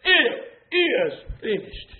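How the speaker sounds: rhythmic, insistent pulpit cadence; driving, repetitive sermon delivery; forceful preaching. Real, not acted. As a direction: roll each phrase out to the congregation.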